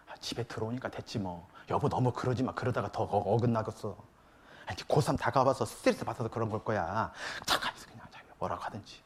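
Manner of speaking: low, soft, appeasing mock murmur; smooth things over under the voice; hushed placating wife. Wife excuses teenager to husband, hushed placating imitation.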